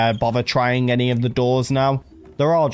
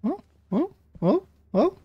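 panicked; 12 semitones higher, 4 dB quieter than usual. Real, not acted. Panicked exclamations.

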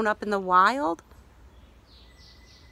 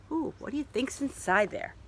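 ordinary delivery

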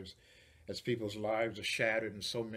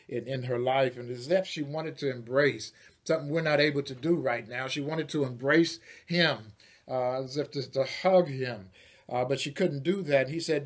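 louder preaching, urgent commands; 6 dB louder than usual. Acted. Preacher giving Jesus' command to the disciples, loud. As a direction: send them out to tell the story.

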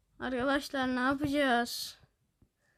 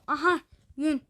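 usual speaking voice

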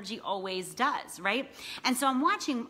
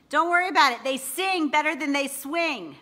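shouted higher and louder, slowing on the punchline; loud taunting shout; 6 semitones higher, 7 dB louder than usual. Retold or imitated. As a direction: yell the jab over the chants. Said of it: "when I shouted that out".

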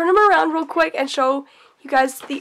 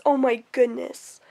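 normal talking voice

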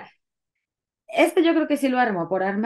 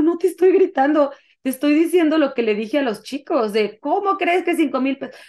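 raised, higher-pitched defensive retort; indignant protest; 6 semitones higher, 4 dB louder than usual. Retold or imitated. Defending herself to partner in a retold quarrel, indignant protest. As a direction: retell defending yourself in a quarrel.

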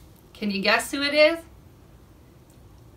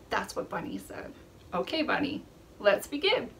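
usual speaking voice

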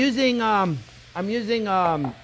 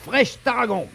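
usual speaking voice

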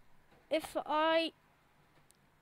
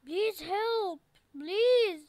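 louder, pleading cry of please; desperate plea; 4 semitones higher, 6 dB louder, much slower than usual. Pretend. Stuck in the game, he loudly begs other players for help. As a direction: beg loudly like you're about to lose.

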